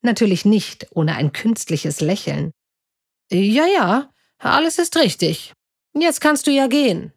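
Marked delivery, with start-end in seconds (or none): 3.3-7.1 higher-pitched, uneasy brush-off; mildly edgy dismissal; nervous. A narrator's reading. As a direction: try to get rid of them, a touch nervously. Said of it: "antwortete Schröder noch nervöser".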